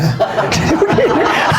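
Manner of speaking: a burst of high laughter. Real, not acted. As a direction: laugh at your own joke. Preacher laughs at own joke.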